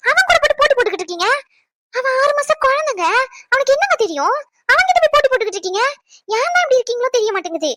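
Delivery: put-on wife angrily scolds husband for blaming the baby; angry scolding. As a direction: tear into him for picking on the baby.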